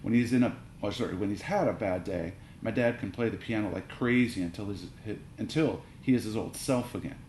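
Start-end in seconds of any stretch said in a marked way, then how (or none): none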